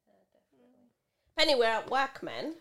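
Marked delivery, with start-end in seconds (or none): 1.4-2.6 loud. Real, not acted. Telling off a friend jokingly.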